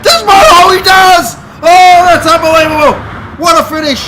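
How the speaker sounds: rising frantic finish call; excited commentary yell. Real, not acted. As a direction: shout the photo finish. Race commentary, close finish climax.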